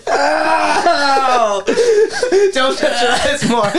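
high, pained laughing yelp; laughing while crying out in pain; laughing through pain. Real, not acted. Eye burning from hot sauce.

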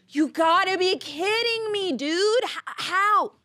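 high, exasperated disbelief; incredulous protest. Retold or imitated. Man reacting to a targeted ad, incredulous. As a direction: pitch it way up in mock outrage.

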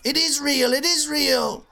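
very high, slow, loud comic yell; hammed-up shout. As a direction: bellow it like a wronged nerd. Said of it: "and ran off".

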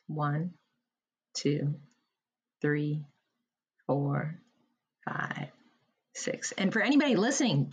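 pace the listener's breaths gently, one count at a time; guided breathing count. Acted. Leads a breathing exercise.

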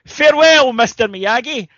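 high, loud grand farewell; drunk guy. Acted. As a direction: call out a parting line drunkenly. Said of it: "he turns around and goes".

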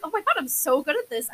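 high, loud mock boasting; mock self-congratulation. Speaker mocks their own British accent attempts, mock boasting. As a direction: gush over your own talent, tongue in cheek. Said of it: "I am always like".

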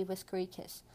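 ordinary delivery

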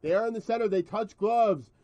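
loud, high ringside play-by-play; fight announcer. Acted. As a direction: call the fight like a commentator. Mock fight commentary.

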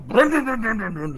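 high, loud imitated motor rev; chainsaw revving noise. Game master plays the orc's chainsaw starting up, revving noise. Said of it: "the orc with the chainsaw".

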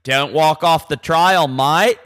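loud, slow mock Aussie nag; hammed-up Australian bloke; Australian accent. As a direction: order them about as a broad Australian guide. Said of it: "middle-aged Australian dudes".

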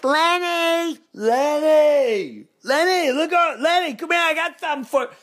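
high, loud taunting call across the stands; heckling yell. Retold or imitated. Fan heckling a Padres player from the stands, high yelling. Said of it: "nonstop, like".

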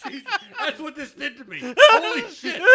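loud, high laughing shout. Real, not acted. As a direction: howl with laughter. Laughing at his outburst.

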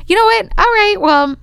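high-pitched, affronted brush-off; offended dismissal. Retold or imitated. Insulted auditioner about to walk out, offended. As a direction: lift the pitch sharply, ready to leave.

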